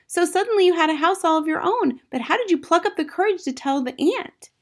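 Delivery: put-on high, eager little-girl voice; excited young Matilda. A narrator's reading. Matilda asking about the aunt, excited high voice. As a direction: gush and question in a child's high pitch.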